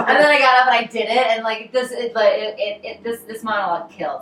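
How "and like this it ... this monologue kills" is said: ordinary delivery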